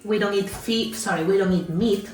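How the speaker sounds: usual speaking voice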